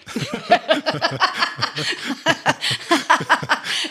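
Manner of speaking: loud, high burst of laughter; real hearty laugh. Real, not acted. Reacts to joke about stage. (herzhaftes, lautes Lachen)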